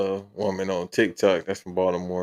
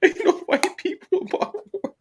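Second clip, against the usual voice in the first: much higher, laughing through a mock South Side voice; laughing impression of homeboy. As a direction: crack up while quoting your homeboy. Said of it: "He was like".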